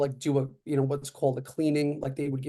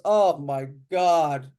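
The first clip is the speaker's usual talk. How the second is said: slow, drawn-out, louder gasp of worry; nervous oh my God; 3 dB louder, much slower than usual. Retold or imitated. Store clerk fretting over a golf novice, slow nervous groan. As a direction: stretch it out like you're dreading it for him. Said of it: "more nervous than I was".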